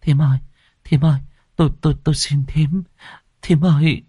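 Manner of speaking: stammered, urgent entreaty to a grieving woman; murmured pleading. A narrator's reading. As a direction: beg her in short, broken bursts. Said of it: "mồm thì thầm".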